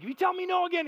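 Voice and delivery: high-pitched exasperated parent warning; heated warning. Retold or imitated. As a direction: warn a small child at the end of your patience. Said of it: "my biggest heated moments".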